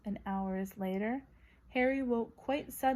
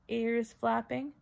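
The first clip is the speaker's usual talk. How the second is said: ordinary delivery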